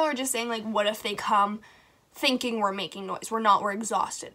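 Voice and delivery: ordinary delivery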